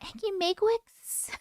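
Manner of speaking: put-on high, soft toddler voice asking; a little girl mangling a name, questioning; imitating toddler. Toddler granddaughter saying auntie's name, high and soft. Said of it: "the way she says".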